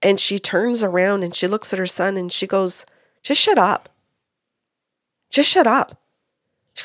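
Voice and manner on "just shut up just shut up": higher, louder mock snap at her son; a mother telling her son to shut up, raised and hard; mother silencing her son, sharp and loud; angry mother.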